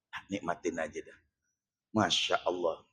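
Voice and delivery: a grateful sigh of acceptance; hushed contentment. Shown as a demonstration. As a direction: breathe it out, soft and low, at peace. Preacher models calm gratitude.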